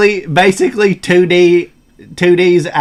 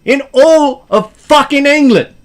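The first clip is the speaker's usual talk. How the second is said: indignant British football fan, climbing high; high, impassioned British fan rant; British accent; 5 semitones higher than usual. Acted. Football fan defending the club, indignant British accent. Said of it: "I wanna hear some British accent".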